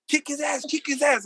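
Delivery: high-pitched mock egging-on; retold heckling. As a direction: imitate her cheering the guard on. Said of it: "the bitch was still talking shit".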